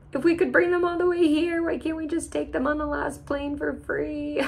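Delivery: high-pitched little plea; hammed-up high protest; mock whining complaint. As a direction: plead in a high, whiny voice. Voicing a complaint about fees.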